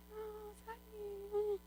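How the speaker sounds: very slow, airy, high mock-feminine greeting; drawn out sweetly like a demure lady; mocking soft woman. Mocking how the women greeted her, slow and sweet. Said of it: "Elles parlaient comme ça, doucement".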